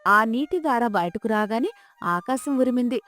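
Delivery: usual speaking voice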